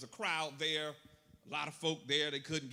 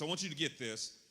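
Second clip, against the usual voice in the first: ordinary delivery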